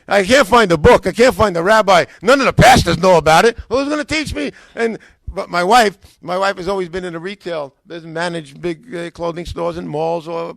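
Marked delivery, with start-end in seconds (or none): 0.1-4.5 loud, emphatic venting, climbing at the end; exasperated complaint. Retold or imitated. Frustrated learner listing dead ends, loudly exasperated. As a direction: pile up the grievances at full volume. Retelling his frustrated search for teaching.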